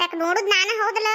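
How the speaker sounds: usual speaking voice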